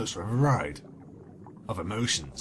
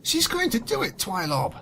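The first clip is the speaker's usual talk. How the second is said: much higher, panicky character voice; alarmed Octopi; 6 semitones higher than usual. Acted. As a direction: squeal the warning in alarm. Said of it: "twitched in alarm".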